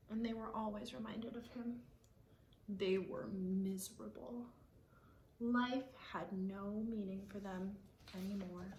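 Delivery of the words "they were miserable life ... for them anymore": hushed, whispered sadness; whispered sorrow. A narrator's reading. Narrator whispering the parents' misery, hushed. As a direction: whisper it like a sad secret. Sad story moment.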